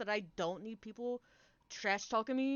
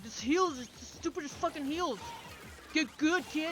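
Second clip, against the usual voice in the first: a touch higher, mocking jab at the healer; mock trash talk; 6 semitones higher than usual. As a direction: sneer at the healer lightly. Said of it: "Or like".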